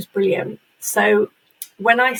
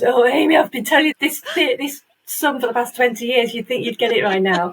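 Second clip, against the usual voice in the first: teasing husband; 4 semitones higher than usual. Retold or imitated. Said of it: "he laughs at me and goes".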